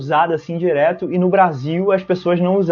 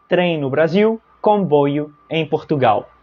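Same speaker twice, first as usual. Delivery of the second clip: ordinary talk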